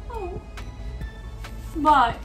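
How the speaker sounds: ordinary delivery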